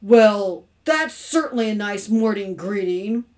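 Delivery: loud, grumpy sarcasm; mildly growling husband; growled grumble. A narrator's reading. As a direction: grumble it sourly. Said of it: "Mister Carmody growled".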